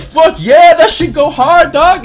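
high, loud hype yell; mock hyped fan. Streamer imitating fans of mumbled songs, hyped mock shouting. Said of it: "And everyone's like".

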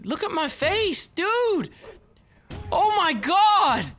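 high, loud, drawn-out wail of shock; hammed-up outrage. Real, not acted. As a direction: play the victim big. Beaten, mock outrage.

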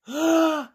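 loud, high acted gasp; big dramatic intake of breath; shocked gasp. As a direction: gasp in storybook horror. Characters shocked at broken toy.

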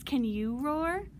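plain reading voice

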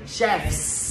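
"Chefs" with a long hissed S after F; plural FS ending. Shown as a demonstration. Teacher showing how the plural reads, FS ending. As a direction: stretch the end of "Chefs" into F-sss. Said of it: "Thầy sẽ đọc là gì?".

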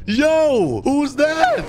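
loud, high, startled call; startled outburst. Pretend. Player spotting a stranger outside, startled. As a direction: blurt it out high in alarm.

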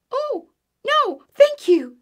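very high, loud frightened squeak; squeaking mouse. A narrator's reading. Little Mouse refusing Bear, high squeak. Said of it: "squeaked Little Mouse".

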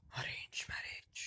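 whispered aside. Real, not acted. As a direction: breathe it out secretly. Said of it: "whispered aside". (फुसफुसाकर कहा हुआ)

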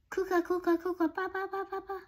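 rising, fading kookaburra cackle call; kookaburra laugh. Acted. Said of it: "my funny friends".

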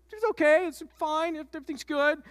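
high, sing-song all-is-well voice; mock reassurance. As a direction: chirp it like nothing could go wrong. Mock reassurance before the twist.

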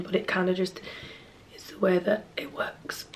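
hushed unvoiced whisper. Real, not acted. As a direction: whisper it secretly. Whispered aside about periods.

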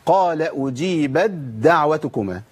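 slow recitation, أجيبت دعوتكما merged as "ujibadda'watukuma"; idgham into dal. Shown as a demonstration. Teacher reciting the second place, ت merged into د. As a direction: turn the ت of أجيبت into a doubled د. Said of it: "الموضع الثاني".

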